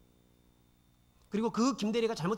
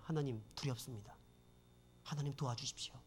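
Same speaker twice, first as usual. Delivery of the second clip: hushed prayer; 7 semitones lower, 11 dB quieter than usual. Retold or imitated. Praying afraid at company gate.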